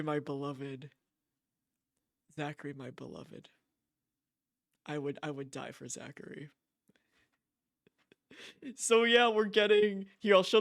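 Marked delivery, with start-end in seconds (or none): 0.0-6.5 softer, lovestruck murmur; tender swoon. Real, not acted. Streamer to chat about her favourite character, softly swooning. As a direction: sigh it out like a crush.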